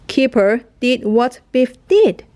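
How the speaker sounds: usual speaking voice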